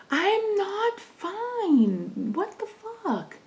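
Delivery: high, drawn-out, strained and disbelieving; strained disbelief. Retold or imitated. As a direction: stretch it out, high and pained, at the news. Retold reaction to bad news.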